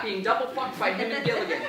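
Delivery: ordinary talk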